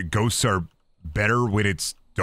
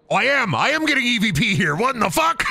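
excited; 11 semitones higher than usual. Real, not acted. Finds EVP, excited.

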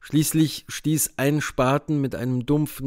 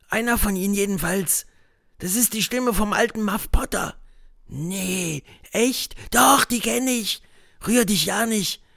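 put-on higher boyish voices, hushed and tense; boys' voices; 7 semitones higher than usual. A narrator's reading. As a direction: play two scared boys. Boys whispering dialogue.

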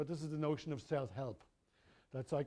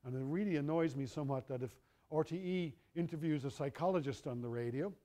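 normal talking voice

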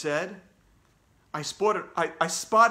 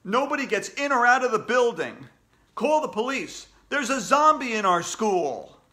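loud, raised, alarmed commands; shouted emergency orders; 7 semitones higher, 5 dB louder than usual. A narrator's reading. Mister Klutz locking down the school, shouting. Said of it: "he shouted".

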